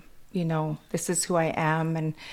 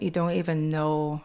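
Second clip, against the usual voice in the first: ordinary delivery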